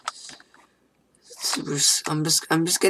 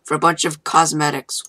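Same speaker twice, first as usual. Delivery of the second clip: normal talking voice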